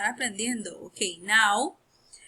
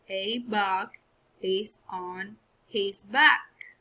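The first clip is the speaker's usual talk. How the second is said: slow read, "back" stressed; slow reading aloud; 3 dB louder, much slower than usual. Shown as a demonstration. Teacher reading the sentence slowly to pupils. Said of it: "let's read the sentence".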